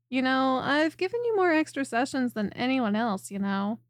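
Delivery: ordinary delivery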